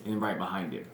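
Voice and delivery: usual speaking voice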